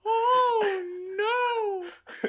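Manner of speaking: very high, drawn-out falsetto lament; mock wail. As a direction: wail in exaggerated high-pitched horror. Joins the Paul Bearer impression.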